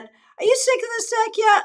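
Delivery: high, teasing aside in a viewer's voice; mock viewer question. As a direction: put on a high-pitched questioning voice. Voices viewers' question about deck.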